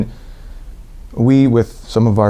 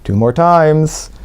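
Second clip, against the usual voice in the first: mild mock yell; 6 semitones higher, slower than usual. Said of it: "a woman yells".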